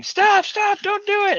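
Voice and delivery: high, loud imitation of frantic viewers; mock yelling. Streamer imitating viewers yelling at him to stop, high mock yell. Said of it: "everyone's yelling".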